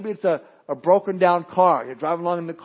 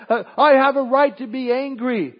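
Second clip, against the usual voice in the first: higher, louder petulant insistence on I; whiny protest; 6 semitones higher, 6 dB louder than usual. Pretend. Believer insisting to God, higher and louder, petulant. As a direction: stamp your foot on the words. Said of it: "And we say".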